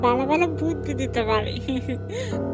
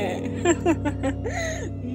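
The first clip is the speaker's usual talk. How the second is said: higher, gloating devil's laugh; evil laugh; 5 semitones higher than usual. Acted. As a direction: laugh with wicked relish. Devil demands a sacrifice. (শয়তানি অট্টহাসি)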